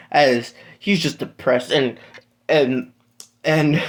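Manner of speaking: plain reading voice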